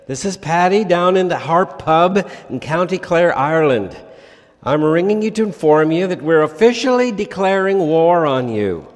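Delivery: hammed-up Irish pub caller declaring war; attempted Irish accent. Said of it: "needs an Irish accent, and I don't have one".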